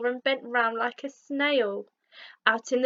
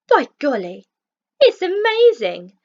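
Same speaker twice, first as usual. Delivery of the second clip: high, loud cry of wonder; overjoyed exclamation; 7 semitones higher, 7 dB louder than usual. A narrator's reading. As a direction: shout it in delighted amazement. Rhino sees his reflection.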